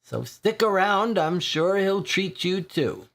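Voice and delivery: a dry regular perking up over a free pint; lightly voiced weary old drinker; thirsty old drinker.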